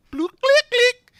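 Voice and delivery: high, loud bloop and clicks; high-pitched phone-line noises; imitated phone clicks. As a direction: mimic the call connecting, high and sharp. Acting out the call being connected.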